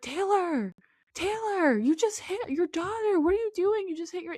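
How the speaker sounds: high-pitched retold outcry; appalled confrontation. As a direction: act out a horrified partner calling her out. Voicing Dakota confronting Taylor in video.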